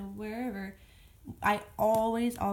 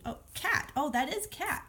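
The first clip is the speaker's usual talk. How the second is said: higher-pitched imitation of a child reader; child discovering a word; 6 semitones higher than usual. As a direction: act out a child suddenly getting the word. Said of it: "hopefully they can be able to, you know, tell you".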